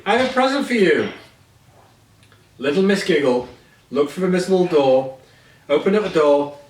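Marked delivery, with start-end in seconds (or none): 0.0-1.1 eager call. A narrator's reading. Calling through door.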